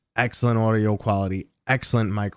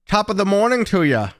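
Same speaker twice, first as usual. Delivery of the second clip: mock Irish greeting; 11 semitones higher, 5 dB louder than usual.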